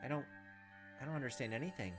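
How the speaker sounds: ordinary talk, nothing marked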